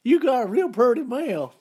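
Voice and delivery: high, loud menacing hillbilly voice; Deliverance hillbilly. Retold or imitated. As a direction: leer it like the backwoods villain. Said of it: "that was Deliverance".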